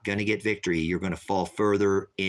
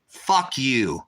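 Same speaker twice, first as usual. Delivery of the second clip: snapping it out hotly, a touch louder; angry curse; 4 semitones higher, 4 dB louder than usual. Real, not acted. Angry streamer cursing out a chat user. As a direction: lose your temper and spit it out. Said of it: "I don't ever cuss".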